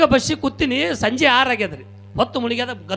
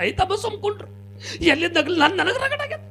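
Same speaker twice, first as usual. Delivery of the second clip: put-on crying old woman; 9 semitones higher, 6 dB quieter than usual.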